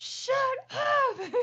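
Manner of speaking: high, loud mock-grouchy kid; hammed-up sulky son; grumpy teen voice. Mom imitating her son's grumpy reply. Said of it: "he's just like".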